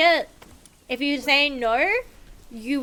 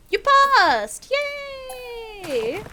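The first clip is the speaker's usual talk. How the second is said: squealed, much higher mock celebration; mock cheer; 11 semitones higher, much slower than usual. Streamer rewarding chat for the right answer, playful cheer. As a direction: cheer like a game show host, half joking.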